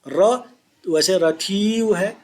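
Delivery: ordinary delivery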